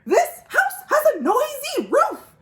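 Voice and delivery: playful owl screech; mock screech. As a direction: shriek it like a frazzled owl. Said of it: "screeched Owl".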